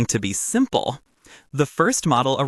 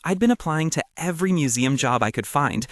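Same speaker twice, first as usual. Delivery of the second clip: usual speaking voice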